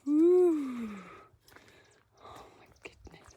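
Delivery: breathless whisper after climbing; gasping for air; shallow breathing. Real, not acted. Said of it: "Sorry for the heavy breathing".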